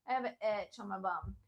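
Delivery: soft throwaway aside; muttered aside. Real, not acted. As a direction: drop it under your breath. Aside during the yoga demo.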